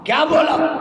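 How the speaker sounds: preacher building suspense; dramatic preaching. Real, not acted. Preacher emphasises.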